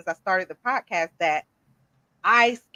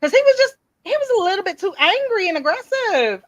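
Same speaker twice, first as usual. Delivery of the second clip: high, louder story burst; excited telling; 9 semitones higher, 5 dB louder than usual. Real, not acted. Host telling cohost about angry worker, high and animated. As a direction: tell it with rising excitement.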